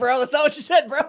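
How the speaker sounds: mock accusation through laughter; ribbing a friend while cracking up; laughing. Real, not acted.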